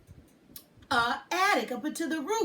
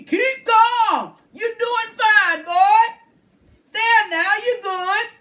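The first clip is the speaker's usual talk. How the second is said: high, loud calming shout; reassuring man; 10 semitones higher, 14 dB louder, slower than usual. A narrator's reading. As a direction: call out to steady the boy. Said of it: "the deep voice of the man".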